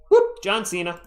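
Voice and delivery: lightly voiced wrestler catchphrase after a high whoop; a quick, half-hearted celebrity impersonation; John Cena. Shown as a demonstration. Streamer showing viewers a party piece, light John Cena impression. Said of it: "see my John Cena impression".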